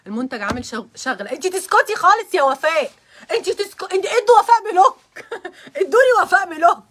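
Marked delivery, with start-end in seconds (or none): 1.3-3.9 loud playful shut-up to a friend; mock scolding. Joking scolding at friend Wafaa. 3.9-6.8 loud high laughing order to block her; laughing mock demand.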